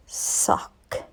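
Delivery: whispered fondly; blowing a kiss to the lucky sock. Acted. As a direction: breathe it softly like a secret. Tender last word.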